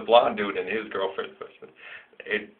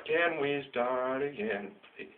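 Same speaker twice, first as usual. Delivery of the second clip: slow, higher pleading request; daughter asking; 4 semitones higher, 6 dB quieter, much slower than usual. Retold or imitated. Dad voicing daughter asking to rewatch, slow and pleading. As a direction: ask sweetly and slowly like a little girl. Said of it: "And she loves".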